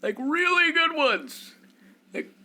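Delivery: high, slow, loud airhead wizard voice; old wizard. Acted. Game master voicing the wizard pitching a quest, airy and high.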